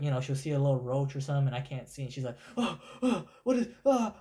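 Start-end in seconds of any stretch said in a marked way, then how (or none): 2.6-4.1 high, startled gasping panic; mock-panicked yelps, pitch jumping; imitated freak-out. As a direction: mimic someone panicking over a bug. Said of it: "and she's freaking out".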